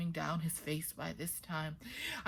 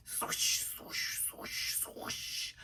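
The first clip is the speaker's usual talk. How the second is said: breathy whispered swoosh of water; 7 dB quieter, much slower than usual. Acted. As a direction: let the river hiss softly like running water. River water voiced as sound.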